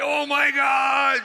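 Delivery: long, high mock shout of awe; crowd losing their minds. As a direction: imitate a stunned crowd crying out, drawn out and loud. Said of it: "the people lose their minds".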